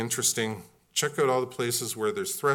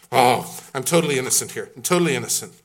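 mock innocence; 8 dB louder, faster than usual. Adam protesting innocence.

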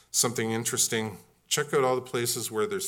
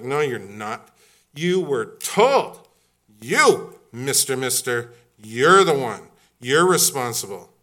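loud, slow scolding rebuke; stern scolding; 9 dB louder, slower than usual. Acted. God sternly scolding Adam for his disobedience. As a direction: drive home the blame, louder.

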